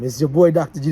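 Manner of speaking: ordinary delivery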